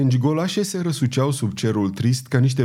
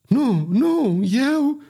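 choking panic; 12 semitones higher, much slower than usual. A narrator's reading. Ferryman choking, cut off underwater.

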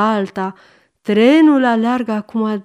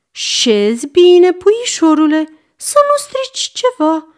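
doting warning; 8 semitones higher, 4 dB louder than usual. A narrator's reading. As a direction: coo a caution to a little darling. Grandmother gently cautions boy. (grijuliu, alintat)

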